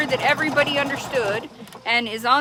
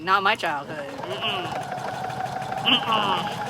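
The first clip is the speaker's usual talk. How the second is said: flat refusal; 3 semitones lower, 5 dB quieter, much slower than usual. Retold or imitated. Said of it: "and I'm like".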